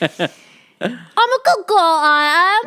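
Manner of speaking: high, loud Cockney wail. Retold or imitated. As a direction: bawl it like Eliza, nasal-free shriek. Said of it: "how many times did she say that".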